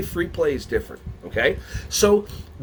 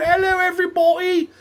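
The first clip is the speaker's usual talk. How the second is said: very high, silly put-on voice; high-pitched comic character voice; changed voice; 13 semitones higher, 4 dB louder than usual. Shown as a demonstration. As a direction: squeal a loud cartoonish greeting. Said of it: "I change my voice".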